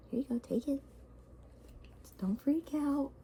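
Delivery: hushed aside to someone nearby; soft aside. Real, not acted. Aside to baby, off-mic.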